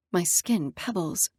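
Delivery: plain reading voice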